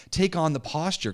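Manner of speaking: ordinary talk, nothing marked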